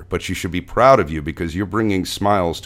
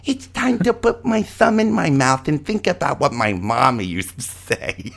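put-on babyish voice; 9 semitones higher than usual. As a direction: say it like a baby sucking its thumb. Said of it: "my little intro for this segment".

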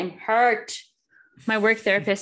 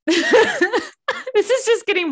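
laughing through embarrassment; giggly, embarrassed confession; 13 semitones higher, 9 dB louder, much slower than usual. Real, not acted. Embarrassed about buying movie.

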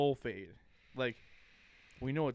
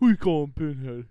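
very loud cartoon indignation; mock-offended shout; cartoon quote; 11 dB louder than usual. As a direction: blast it out like an offended cartoon character. Repeats cartoon quote loudly.

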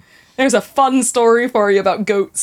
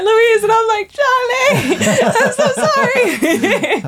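high-pitched mock call and apology, laughing through it; squeaky excited voices; 12 semitones higher, slower than usual. Retelling a child calling her name, high laughing mock voices. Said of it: "and he was like".

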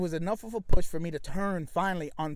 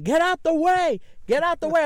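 high, loud yell out a window; 10 semitones higher, 6 dB louder than usual. Retold or imitated. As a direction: re-enact yelling to clear the street. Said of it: "I'm yelling".

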